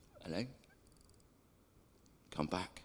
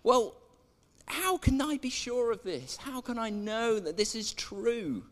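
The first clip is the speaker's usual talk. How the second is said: much higher, pleading voice; anxious questioning; 8 semitones higher than usual. Retold or imitated. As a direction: ask nervously for proof. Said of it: "and he's saying".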